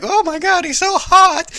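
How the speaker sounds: high gushing squeal; swooning fangirl. Retold or imitated. Ariel sees the prince, high swooning squeal. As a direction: gush like a smitten teen. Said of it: "Ariel's all like".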